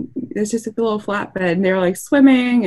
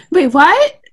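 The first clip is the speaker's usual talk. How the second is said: a higher, louder startled wait what; retold shock at hearing of the crash; shocked disbelief; 9 semitones higher, 6 dB louder than usual. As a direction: react as if hearing the news for the first time. Retelling shocked reaction to news.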